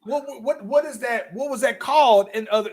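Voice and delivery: usual speaking voice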